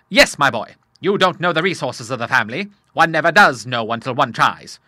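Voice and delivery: put-on a touch of a jolly old sailor cheering up his son; father reassures his troubled son, lightly gruff; bluff old Admiral. A narrator's reading. Admiral's held voice.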